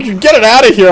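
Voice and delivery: high, loud outraged shouting; shrieking outrage over the box; retold furious yell. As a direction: yell it like the disgusted big brother who found it. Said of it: "And he's like".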